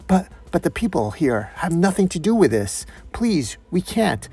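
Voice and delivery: high, stammering, pleading voice; timid nurse pleading; frightened plea. Acted. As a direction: beg for the bystanders' lives. Nurse pleads for bystanders' lives.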